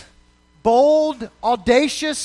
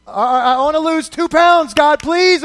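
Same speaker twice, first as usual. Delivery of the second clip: higher, louder, hammed-up pleading to God; mock begging prayer; 4 semitones higher, 6 dB louder than usual. Preacher mocking a petty prayer, hammed-up pleading. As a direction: beg God for something trivial, overdone. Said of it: "you're like".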